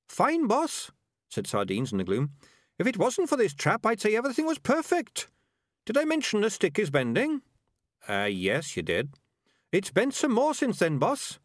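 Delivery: breezy little rat, unbothered by danger; cheery rat voice. Acted. Sardines trapped behind the dresser.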